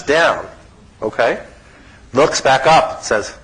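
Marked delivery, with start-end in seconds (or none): none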